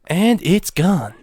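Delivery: milk the loss dramatically; slow hammed-up sorrow; mock tragic lament. Dramatic lament over lost audio.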